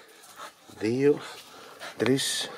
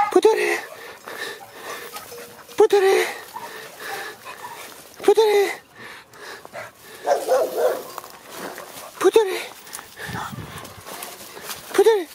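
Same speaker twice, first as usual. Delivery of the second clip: high-pitched call. Real, not acted. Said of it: "την ψηλή, σε ψηλή συχνότητα φωνή".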